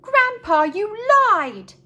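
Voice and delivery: put-on young Frank crying foul at his grandpa; outraged boy. A narrator's reading. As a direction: blurt it out like a shocked kid.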